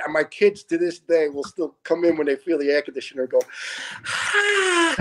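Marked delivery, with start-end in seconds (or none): 4.1-4.9 a high drawn "Ah" of relief; imitated sigh of relief. Showing what the kids do when the AC kicks on, high sigh. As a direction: mimic the old-timer's bunk sigh. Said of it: "and I would do that".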